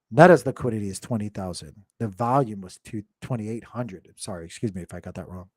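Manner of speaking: ordinary delivery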